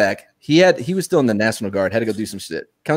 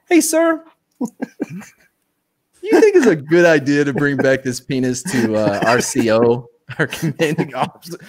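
laughing question; 2 semitones higher, slower than usual. Retold or imitated. Soldiers question lieutenant, laughing.

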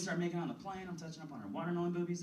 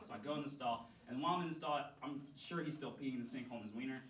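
ordinary talk

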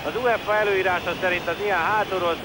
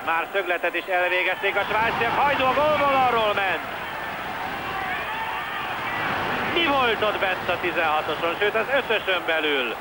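excited hungarian sports commentary; 7 semitones higher than usual. Real, not acted. Near miss, commentator excited.

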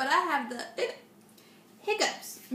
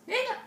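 squeaky jumped-up hiccup on the word; cute little piglet hiccup; high hiccup; 11 semitones higher than usual. Acted. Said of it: "all that came out was".

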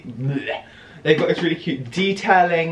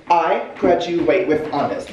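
ordinary talk, nothing marked

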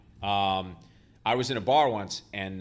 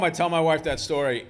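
normal talking voice

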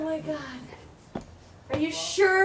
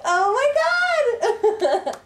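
high squeal of laughing disbelief; shrieking laugh; 7 semitones higher, slower than usual. Real, not acted. Laughing shock at spider.

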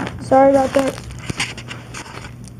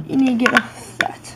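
hushed instruction to the toy; whispered aside; 4 semitones lower, 3 dB quieter than usual. Real, not acted. Child tells the dragon to get off, whispered. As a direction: whisper it under your breath. Quiet aside while playing.

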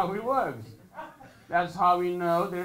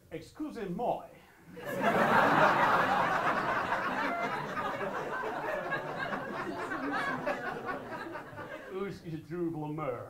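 asking the way in French; 7 semitones higher, 4 dB quieter than usual. Shown as a demonstration. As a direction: put on a light French accent. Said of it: "I do it like this".

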